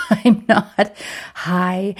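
laughing through the words. Real, not acted. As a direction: giggle the denial out, slowly.

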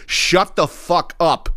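slow, high-pitched mock outburst; exasperated outburst. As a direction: draw out every word at an imagined pest. Imagined outburst at the yapping customer.

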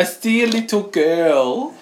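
put-on high, slow little boy voice; son's creepy line. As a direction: imitate a small child, loud and drawn out. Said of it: "You know what he said?".

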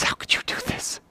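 acted overwhelmed, voiceless hush; tearful whisper. Peter to Jesus after the huge tip, tearful whisper. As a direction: whisper it as if bawling. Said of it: "Peter starts bawling".

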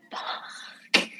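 hushed, voiceless curse to herself; dismayed whisper. Retold or imitated. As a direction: whisper it in retold dread. Retelling dismayed inner reaction.

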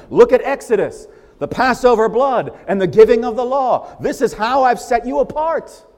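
rising pulpit intensity; fervent preaching. Acted. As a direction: preach it hard, pushing each command. Preaching John's words, fired up.

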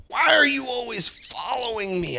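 sharp accusing mock yell; a touch of a startled classmate snapping; accusing girl. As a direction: fling it like an annoyed girl. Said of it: "whipped around and was like".

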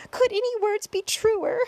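high, loud delighted exclamation; cheering cry. A narrator's reading. Spider clapping for the earthworm's praise, cheering cry. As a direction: cry out in enthusiastic agreement. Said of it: "clapped and cheered and cried".

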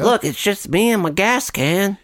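high, slow Southern twang; Alabama accent. Pretend. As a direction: drawl it like an Alabama yokel. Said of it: "he's suddenly from Alabama".

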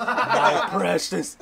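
slow, drawn-out creature rasp through a laugh; stretched, laughing Gollum impression. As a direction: draw out precious like Gollum, half laughing. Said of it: "ese Gollum, güey. Gollum, Gollum".

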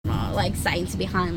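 normal talking voice

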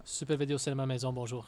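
mock French accent. Ex-clerk names his old video store in mock French.

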